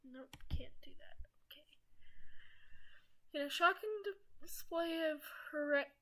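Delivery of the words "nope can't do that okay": whispering. Real, not acted. Whispered aside to viewers.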